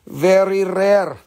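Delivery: "rare" dragged slow with a rolled, stretched R; "Very rare" said slowly, R drawn out long; extra R. Shown as a demonstration. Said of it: "Rare with an extra R".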